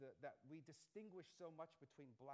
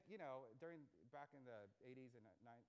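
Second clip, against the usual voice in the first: normal talking voice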